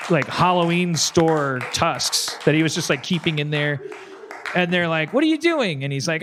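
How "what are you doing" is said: high-pitched, incredulous question; acted bewilderment. Worker to the seal throwing down tusks, baffled. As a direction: ask it high, as a baffled bystander. Worker startled by seal's exit.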